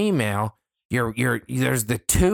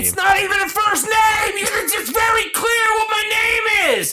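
much higher, loud ranting shout; frustrated shout; 4 dB louder than usual. Real, not acted. Shouting at coworkers who botch his name. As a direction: yell it out, fed up.

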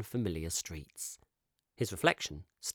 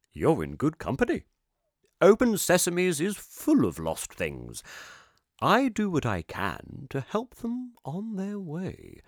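higher, louder character voice, jolly and knowing; kindly eccentric shopkeeper; 8 semitones higher, 4 dB louder than usual. Acted. Shopkeeper teasing Kip gently about being lost. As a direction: charm the lost boy.

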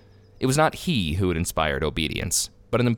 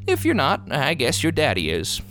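hesitant admission; 5 semitones higher than usual. A narrator's reading. Clerk allowing that the boy's daddy can pay, hesitant. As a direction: start high, then settle. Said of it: "admitted the clerk, hesitantly".

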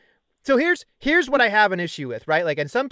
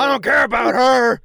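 a louder, higher put-on brute voice, shrugging it all off; dismissive Braun impression; 5 semitones higher, 6 dB louder than usual. Mocking the wrestler's promo, a put-on careless brute voice. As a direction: sneer the line out like a careless brute. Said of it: "he's like".

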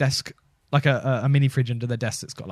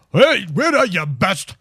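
higher, louder mock Scottish yell; angry delivery man; 6 semitones higher, 7 dB louder, slower than usual. Michael Mintz buzzing the intercom, angry mock yell. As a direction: bark it like an annoyed courier at the door. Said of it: "he was angrily going".

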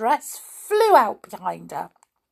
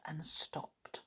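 whisper; 5 semitones lower, 21 dB quieter than usual. A narrator's reading. Narration, unvoiced.

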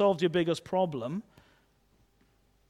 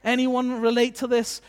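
usual speaking voice

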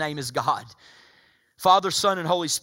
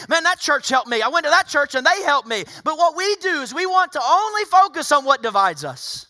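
loud, high, driving sermon delivery; full-force preaching; 12 semitones higher, 10 dB louder than usual. Real, not acted. Pastor urging the congregation toward a church campaign, full-force preaching.

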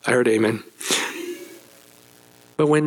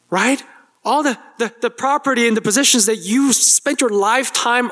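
higher, aggrieved complaint about fairness; indignant older son; 8 semitones higher than usual. Acted. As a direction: voice the son's wounded protest, pitched up. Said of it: "There's anger in the older son's voice".

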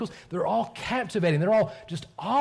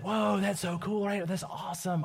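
mock amazement; 4 semitones higher, 4 dB quieter than usual. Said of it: "maybe at some point you've said".

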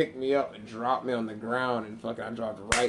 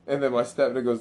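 normal talking voice

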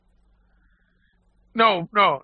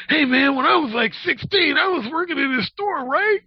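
hammed-up kid bragging the story; teen stock boy; 12 semitones higher than usual. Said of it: "he's telling somebody that story".

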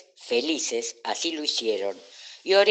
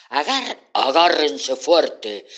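loud, stretched-out warning; urgent call; 2 semitones higher, 7 dB louder, slower than usual. A narrator's reading. Oreo warning new friends before leaping, loud urgent call. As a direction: call out loudly to hold tight.

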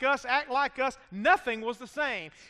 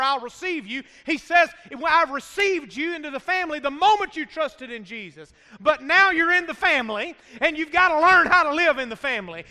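loud, raised, driving sermon delivery; full-force preaching; 4 semitones higher, 6 dB louder than usual. Real, not acted. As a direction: hammer each point home to the congregation.

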